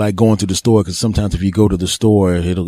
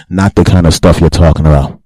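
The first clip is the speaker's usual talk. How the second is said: louder naughty aside, tee hee; loud cheeky aside; 4 semitones lower, 7 dB louder than usual. Real, not acted. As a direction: punch the dirty joke loud, then giggle. Naughty joke with giggle.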